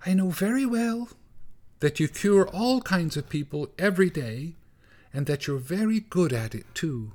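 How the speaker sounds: mother mouse voice. A narrator's reading. Mother mouse begs Gorsh to cure her child, high pleading voice. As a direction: beg like a tiny anxious mother mouse.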